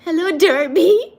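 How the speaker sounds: real laughing-crying voice. Real, not acted. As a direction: greet them while laughing to tears. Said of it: "I'm laugh crying".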